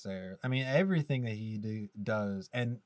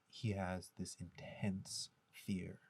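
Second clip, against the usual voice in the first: hushed creepy whisper; spooky whisper; 3 semitones lower, 12 dB quieter, much slower than usual. Retold or imitated. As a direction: whisper it like a ghost story. Mimics the priest's creepy explanation.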